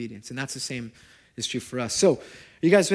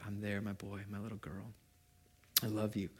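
very soft, gentle and hushed; tender voice of God; 5 semitones lower, 15 dB quieter than usual. Retold or imitated. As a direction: speak like a loving father, almost under the breath. God answers lovingly.

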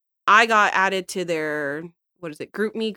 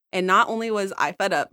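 usual speaking voice

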